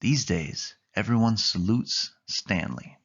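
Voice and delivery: plain reading voice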